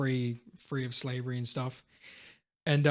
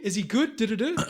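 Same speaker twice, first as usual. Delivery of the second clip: put-on high, rising, louder old man's plea; blind father, urgent; 9 semitones higher, 4 dB louder than usual. Father learns news of his son, high and urgent. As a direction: push the old father's voice up in alarm.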